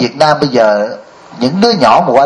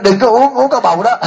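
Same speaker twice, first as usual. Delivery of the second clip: put-on childish warning; 8 semitones higher than usual. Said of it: "tôi nói".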